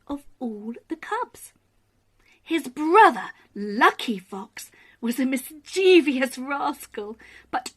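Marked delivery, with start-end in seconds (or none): none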